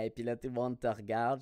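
normal talking voice

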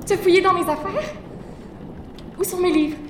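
shocked outrage. Acted. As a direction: she just found out her things were searched. Annette discovers her books taken.

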